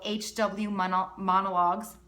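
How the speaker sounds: real slip on "Mono-, Monologues", cut short then said whole; tongue-tied stumble. Real, not acted. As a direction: break off mid-word and restart. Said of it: "can't speak today". (tripping over "Monologues")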